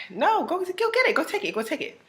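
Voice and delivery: pitched high, insistent pushing; high urging. Retold or imitated. Friend pushes her to take the test, high and insistent.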